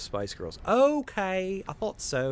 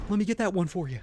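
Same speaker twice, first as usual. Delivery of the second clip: voicing the camera; 9 semitones higher, 6 dB louder, faster than usual. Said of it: "It's like".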